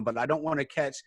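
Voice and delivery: ordinary talk, nothing marked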